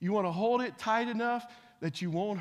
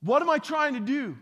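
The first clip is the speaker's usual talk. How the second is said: ordinary talk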